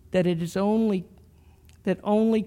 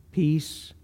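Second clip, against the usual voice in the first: usual speaking voice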